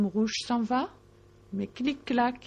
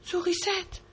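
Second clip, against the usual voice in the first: high worried family voice; 9 semitones higher than usual. A narrator's reading. Family in tears calls her.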